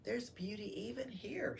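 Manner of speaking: quiet comfort in a dark cave; hushed reading. A narrator's reading. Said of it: "she said".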